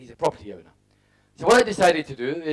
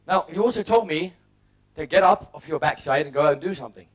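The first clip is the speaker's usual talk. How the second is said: ordinary talk, nothing marked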